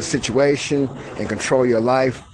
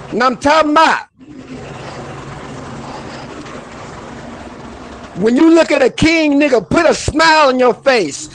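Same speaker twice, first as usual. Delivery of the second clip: much higher and louder, fired up; fired-up motivational rant at viewers; worked-up rant; 10 semitones higher, 3 dB louder than usual. Acted. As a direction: preach at them with frustration. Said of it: "And I'ma calm down".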